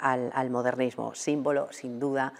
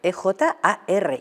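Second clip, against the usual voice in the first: slow letters, a little higher; spelling back; 4 semitones higher, 3 dB louder, much slower than usual. Real, not acted. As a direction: repeat the letters slowly to check. Repeats the spelling while writing. (repitiendo las letras despacio)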